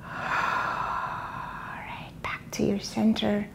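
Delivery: soft unvoiced relaxation whisper; whispered cool-down.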